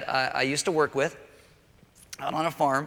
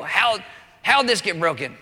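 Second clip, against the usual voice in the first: loud, accusing parent voice; 4 semitones higher, 5 dB louder than usual. Retold or imitated. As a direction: demand it like an angry parent. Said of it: "they go".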